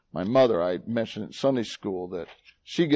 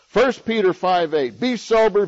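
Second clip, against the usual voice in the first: higher, louder warning from the pulpit; preacher's loud warning about the roaring lion; forceful preaching; 5 semitones higher, 6 dB louder than usual. Acted. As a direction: sound the alarm like a watchman.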